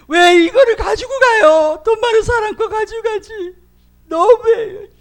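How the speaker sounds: high, loud tearful wail; crying complaint. Retold or imitated. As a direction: sob out the protest to God. Weeping complaint to God.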